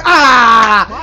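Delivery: sudden panicked scream; frightened shriek. Real, not acted. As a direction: shriek as the enemy shoots. Killed by enemy mid-sentence.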